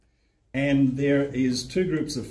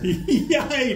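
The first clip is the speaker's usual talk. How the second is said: high, laughing "yay"; delighted high-pitched whoop; triumphant cheer; 11 semitones higher, 4 dB louder than usual. Real, not acted. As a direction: celebrate with the class, laughing. Cheering after class finishes chant.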